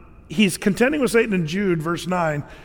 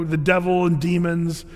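normal talking voice

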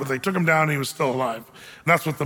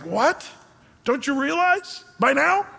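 incredulous question, pitched up at the end; mild exasperation; 8 semitones higher, slower than usual. Retold or imitated. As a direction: act out a teacher astonished at his student. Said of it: "he's almost exasperated".

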